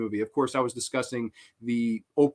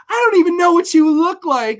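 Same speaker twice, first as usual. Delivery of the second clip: put-on high, loud airy girl voice; mock ditzy girl; girly voice; 9 dB louder than usual. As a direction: play a clueless girl, way up high. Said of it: "She's like".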